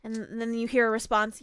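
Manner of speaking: usual speaking voice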